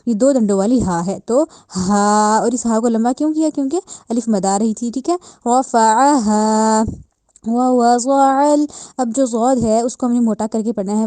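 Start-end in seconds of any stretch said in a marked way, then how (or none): 1.3-2.4 ها breathed from the chest, held long. Shown as a demonstration. Teacher models chest-sounded ها, slow. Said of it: "سینے والی side سے ادا ہوگا". 5.5-8.7 slow chanted رفعها ووضع, louder; tajweed recitation. Shown as a demonstration. Teacher chants the verse again as model.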